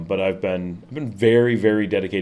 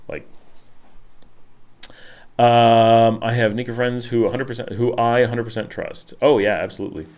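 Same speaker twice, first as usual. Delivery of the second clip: usual speaking voice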